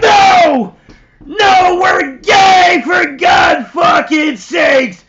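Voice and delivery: high, loud mock-desperate refusal; hammed-up shouted protest; frantic shout. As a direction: yell it to get her to stop.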